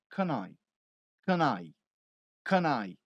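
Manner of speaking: "Can I" linked into one word, canai; connected speech. Shown as a demonstration. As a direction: glide Can into I. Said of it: "Então, você vai falar". ("Can I" emendado numa palavra só)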